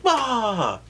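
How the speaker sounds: a sudden high, loud "Ah!"; mock startled yelp. Narrator miming a diner startled by the pee, yelping. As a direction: jump as if pee hits your food. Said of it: "and then be like".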